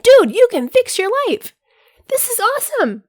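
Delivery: over-eager hype voice; silly excited pitch. Pretend. Jumping in people's faces, high excited gushing. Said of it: "Now I sound real silly".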